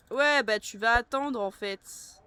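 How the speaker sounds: loud, slow, drawn-out sass; loud slow teasing retort. Real, not acted. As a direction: rub it in to the viewers. Telling viewers they'll wait.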